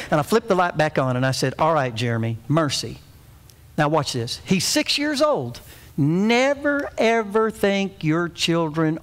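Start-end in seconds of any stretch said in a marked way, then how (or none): 1.6-3.0 low, restrained fatherly tone; serious. Retold or imitated. Father turns the light back on, serious. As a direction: keep it serious, hiding the laugh. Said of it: "I'm serious about this".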